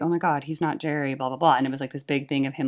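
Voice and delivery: ordinary delivery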